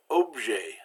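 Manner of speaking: objet said slowly in French, the final t silent; silent final letters. Shown as a demonstration. Teacher showing silent letters, objet with a mute t. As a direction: end objet on an open "ay" vowel, no t. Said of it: "We've got two silent letters there".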